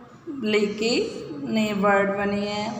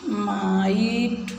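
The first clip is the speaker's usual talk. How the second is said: slow model word; much slower than usual. Shown as a demonstration. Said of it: "teacher models word".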